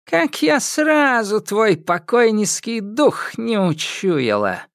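put-on high, slow old witch; scornful old witch drawing it out. Яга говорит с Кощеем. (голос старой ведьмы)

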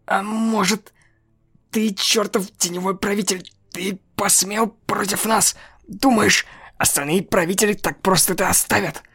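high, strange menacing creature voice; menacing statue voice. Acted. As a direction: taunt like an otherworldly statue. Held voice of angel statue.

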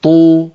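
طو said open and easy; voweled taa. Shown as a demonstration. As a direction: let طو flow with its vowel. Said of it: "نجرب في حرف الطاء". (طاء مضمومة بلا ضيق)

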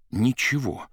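plain reading voice

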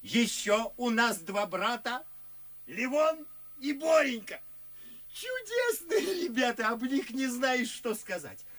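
high, loud, near-tearful delight; very high, loud enthusing; gushing rapture. Acted. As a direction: overflow with adoration. Gushing about two brothers.

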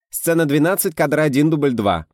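loud, high, quick slate call; brisk rattling off. A narrator's reading. Script girl announcing the slate to the crew, brisk loud call. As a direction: rattle off the take number briskly.

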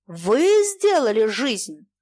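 slow, stretched-out outcry; shouting. A narrator's reading. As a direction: cry it out slowly in accusation. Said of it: "крикнул он". (протяжный обличительный выкрик)

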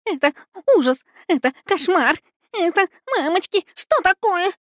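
high panicked cries, rising; desperate wailing. A narrator's reading. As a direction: wail in terror. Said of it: "разорвали отчаянные вопли". (отчаянные вопли)

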